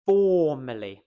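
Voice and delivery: "Formerly" with a loud, long FOR; big emotive first syllable in "Formerly"; stress on FOR. Shown as a demonstration. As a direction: stretch FOR and let "merly" fall away. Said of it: "The for is what's important".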